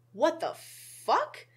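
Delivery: rising to a high startled fuck; jolted double take at the mirror; mock startled what the fuck; shocked. Shocked seeing own face.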